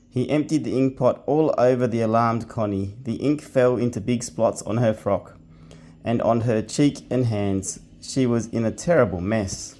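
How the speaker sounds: put-on reading a storybook to kids at bedtime; bedtime story reading. A narrator's reading. Dad reads bedtime story aloud.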